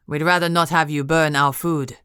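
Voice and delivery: put-on Spanish accent, imperious count; thick Spanish accent. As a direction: lay on a heavy Spanish accent, lordly. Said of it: "He spoke in a deep, booming voice and a thick Spanish accent".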